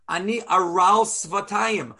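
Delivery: normal talking voice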